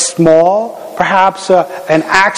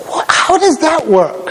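puzzled wonder; 10 semitones higher than usual. Retold or imitated. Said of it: "I think".

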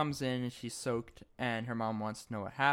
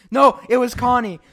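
higher, louder accusing call; mock accusation; 10 semitones higher, 13 dB louder than usual. Among Us style accusing Connie, loud and higher. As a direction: blame her loudly like in Among Us. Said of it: "so many other situations".

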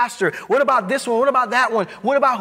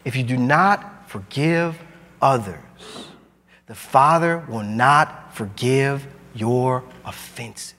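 slow weighty repeat; 8 semitones lower, slower than usual. Shown as a demonstration. Pastor repeats the hard verse so the congregation lets it sit, slow and low. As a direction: lower and slow, every word weighed. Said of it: "Let that sit".

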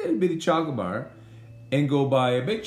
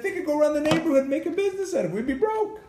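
panicked excitement; 14 semitones higher, faster than usual. Real, not acted. Husband panics about kids' candy business.

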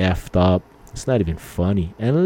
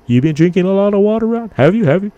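high, fast, louder pestering questions; nagging friend; 12 semitones higher, 6 dB louder, faster than usual. Retold or imitated. Friend pestering Ron about water, high, fast and loud. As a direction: badger him like a nagging buddy.

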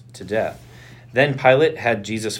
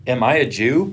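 louder, rising retort; 3 semitones higher, 5 dB louder than usual. A narrator's reading. Pilate answers Jesus's question with a retort. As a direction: throw the question back, a touch indignant. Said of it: "Pilate retorted".

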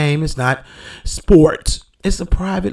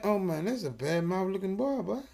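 usual speaking voice

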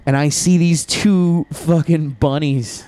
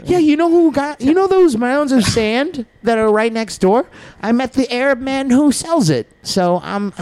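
higher, hurried fib; rambling excuse; 8 semitones higher than usual. Retold or imitated. Covering up to coworkers, higher rambling fib. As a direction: make up a story fast. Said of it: "And I was like".

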